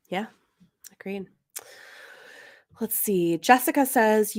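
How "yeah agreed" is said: soft agreement. Real, not acted. Quiet agreement.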